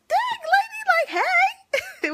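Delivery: high, loud mock call; mock shout. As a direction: call out in a sudden mock greeting. Greeting a staring stranger.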